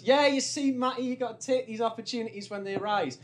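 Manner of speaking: chirpy lads' advice from a mate; high, breezy, know-it-all mate voice; mate's advice. Retold or imitated. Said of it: "And he goes".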